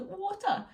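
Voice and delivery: put-on "water" said high like a toddler, falling; toddler's water. Mum copying how her daughter says "water", high and falling. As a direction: squeak "water" as a little girl does. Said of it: "That's how she says it".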